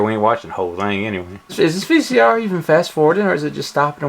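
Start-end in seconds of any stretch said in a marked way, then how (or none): 1.5-4.1 lightly posh lady's voice; a hint of a prim lady voice; man doing a woman's voice. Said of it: "Why are you talking majestic for?".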